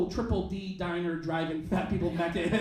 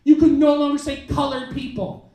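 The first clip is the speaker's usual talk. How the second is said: mock scolding; 5 semitones higher, 9 dB louder than usual. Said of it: "she marches up to the table".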